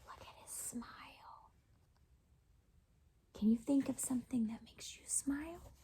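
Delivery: very soft hush; whisper. Real, not acted. Asking the child what makes them smile, whisper. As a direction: whisper a tender question.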